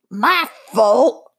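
gruff goblin shouting; angry little goblin yelling. A narrator's reading. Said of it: "was yelling at the witch".

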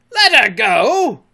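slow, high, loud disbelief; drawn-out outraged shout; outraged cry. A narrator's reading. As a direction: echo it as an incredulous bellow. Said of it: "cried Hook".

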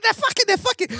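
high-pitched panicked whisper-shout; frantic squeal. Retold or imitated. Stoned friend squeals to his mate about the car. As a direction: squeak it out in a frenzy. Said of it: "I was like".

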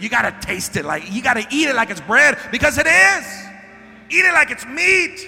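high, loud preacher's cry; full-force preaching. Acted. Preacher urging the congregation to eat the word, shouting. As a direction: hit it like a revival climax.

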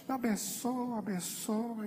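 grudging blessing. Retold or imitated. Believer praying for someone he resents, grudging and drawn-out. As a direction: bless him through clenched reluctance, stretching each word. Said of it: "abençoa ele".

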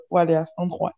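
ordinary delivery

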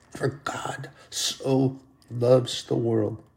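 slow, breathy whisper of scripture; whispered verse. Acted. Preacher quoting scripture to the church, slow whisper. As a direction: hush it like a sacred secret.

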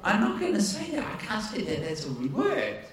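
lightly voiced, quiet protest to God; half-laughing private refusal, hushed; a hint of a sheepish inner voice; inner talk with God. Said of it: "my discussion with God".

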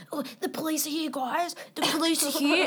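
lightly voiced panicky party guy; half-hearted alarm call. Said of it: "there's always one guy who's like".

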